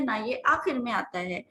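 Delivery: ordinary delivery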